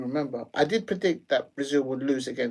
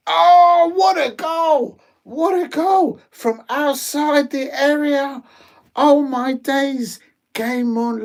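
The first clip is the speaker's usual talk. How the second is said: much higher and louder, slow, stretched cry of joy; goal celebration; 9 semitones higher, 7 dB louder, slower than usual. Real, not acted. Streamer seeing a USA goal from outside the area, ecstatic shout. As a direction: roar it out as the ball hits the net.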